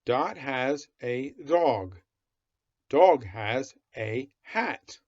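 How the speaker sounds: slow, word by word; slow reading aloud. Shown as a demonstration. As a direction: read each word slowly to a child. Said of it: "go nice and slow".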